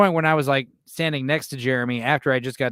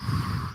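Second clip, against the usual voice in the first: long, audible breath; demonstrated chest breath; deep breath demo; 7 dB quieter than usual. Shown as a demonstration. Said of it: "When you take a deep breath".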